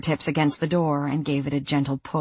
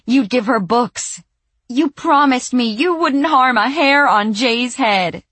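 high, loud, betrayed shouting; furious accusation; 8 semitones higher, 6 dB louder than usual. Acted. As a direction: hurl the broken promises at them. Sandy accusing them of betrayal.

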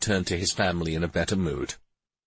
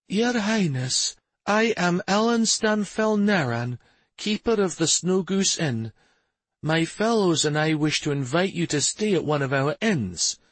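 put-on high-pitched, measured older woman; 8 semitones higher than usual. A narrator's reading. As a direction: address royalty formally in a high older woman's voice. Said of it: "An older woman took the lead, bowing very deeply".

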